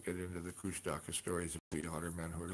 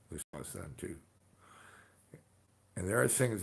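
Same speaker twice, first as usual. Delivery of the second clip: ordinary talk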